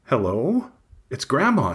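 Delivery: lightly voiced grandma on the phone. A narrator's reading.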